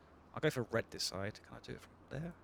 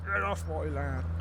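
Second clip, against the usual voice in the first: put-on slow, louder, higher old farmer yell; grumpy farmer shout; 3 semitones higher, 7 dB louder, slower than usual. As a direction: shake your fist and bellow it slowly. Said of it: "shaking my fist at this car".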